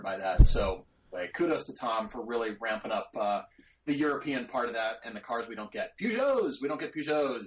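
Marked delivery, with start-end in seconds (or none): 6.0-7.5 excited exclaim. Real, not acted. Listing European cars they don't get, excited exclaim. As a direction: shout it with glee.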